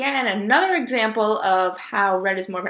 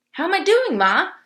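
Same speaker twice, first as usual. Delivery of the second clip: put-on eager child; 10 semitones higher, 5 dB louder than usual. A narrator's reading. Baby possum asks proudly.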